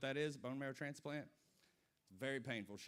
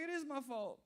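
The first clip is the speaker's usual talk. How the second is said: guilty mom; 11 semitones higher, 4 dB louder than usual. Retold or imitated. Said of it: "She's like".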